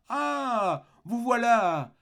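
exclamation haute et joyeuse; s'écrie. Acted. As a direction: accueille le voleur comme un ami. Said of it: "s'écria-t-il". (l'évêque s'écrie, voix très haute)

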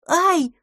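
very high startled yelp; high kitten squeal of pain. A narrator's reading. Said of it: "завизжал Бэби-малыш". (пронзительный визг котёнка)

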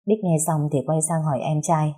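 usual speaking voice

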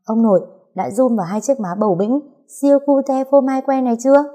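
put-on child's fussy inquiry, rising in pitch; mildly fretful little-kid question; earnest questioning. A narrator's reading. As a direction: quiz grandpa with self-important concern. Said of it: "chau mày hỏi han".

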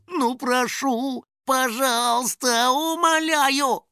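high, slow, drawn-out begging; pleading. A narrator's reading. House spirit begging the door to open, pleading. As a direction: beg pitifully, stretching every word. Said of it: "решил сменить просьбы на мольбу".

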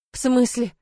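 ordinary delivery, nothing marked

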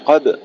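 "قب" cut on an echoing b, no vowel; qalqala. Shown as a demonstration. Teacher isolating the sound, "قب" with bounced ba. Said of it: "القلقلة".

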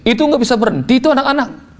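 high-pitched exasperated mock yell; high, strained retold complaint. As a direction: cry out in exasperation, pitched high without full volume. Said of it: "saya teriak di kamar saya".

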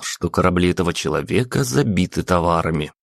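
ordinary delivery, nothing marked

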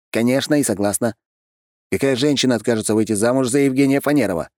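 lightly voiced self-assured groom; boastful groom. Groom assuring friends the bride agreed, mildly boastful voice.

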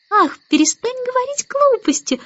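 put-on very high, whiny little-girl voice; whimpering. A narrator's reading. Baby Annabel tells the starling to stop, high whimper. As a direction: whine it like a fretful baby. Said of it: "захныкала Аннабелла".

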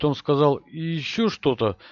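ordinary delivery

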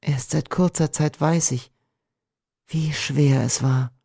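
full of tenderness. A narrator's reading. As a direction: answer lovingly, lingering at the end. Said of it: "antwortete sie voll Zärtlichkeit".

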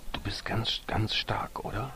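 put-on boy urging the elephant in a whisper. A narrator's reading. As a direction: coax the elephant in a secret whisper.

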